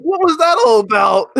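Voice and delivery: high, loud, cracking up; laughing bafflement. Retold or imitated. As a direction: blurt the baffled question through laughter. Retold reaction amid laughter.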